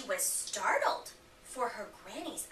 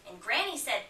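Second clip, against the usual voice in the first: ordinary delivery, nothing marked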